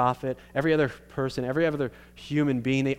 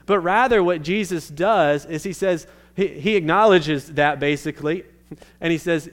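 louder, higher, driving the point home; preaching at full force; 5 semitones higher, 6 dB louder than usual. Real, not acted. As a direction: preach it out big to the room. Preaching climax, much louder.

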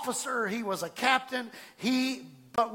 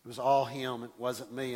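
false humility; 8 semitones lower than usual. Retold or imitated. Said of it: "I would say things like this".

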